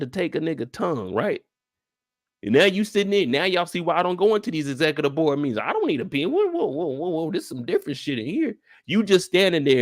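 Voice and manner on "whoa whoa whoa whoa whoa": ordinary talk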